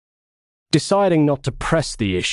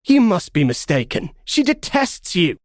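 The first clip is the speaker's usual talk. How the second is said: high, disbelieving protest; incredulous; 8 semitones higher than usual. Acted. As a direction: refuse to believe it. Axios disbelieving.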